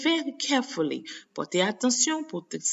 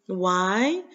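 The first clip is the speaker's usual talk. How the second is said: usual speaking voice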